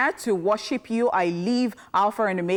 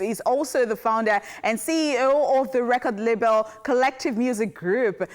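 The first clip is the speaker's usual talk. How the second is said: bright, higher announcer intro; announcer read; 5 semitones higher than usual. Acted. Host introducing a celebrity birthday, upbeat announcer voice.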